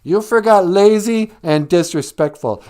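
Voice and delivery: loud, high sneering mother; nasty mother. Retold or imitated. Bender's mother adding insults, mock sneer. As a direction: pile on with snide cruelty. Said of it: "the mom was like".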